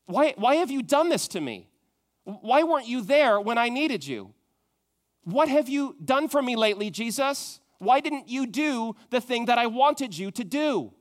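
loud, high, indignant demands; whiny, aggrieved questions thrown at God; complaining to Jesus. Retold or imitated. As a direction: voice the petulant believer venting at Jesus. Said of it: "And we say".

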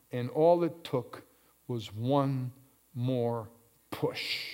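low, soft, suspenseful preaching; hushed sermon storytelling. Real, not acted. Preacher telling of Samson in the temple, hushed storytelling. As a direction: drop right down and let the pauses hang.

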